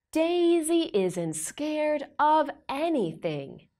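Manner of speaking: ordinary delivery, nothing marked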